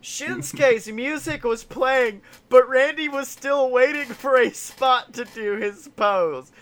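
normal talking voice

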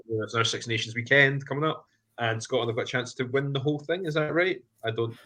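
ordinary delivery

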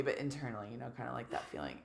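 ordinary talk

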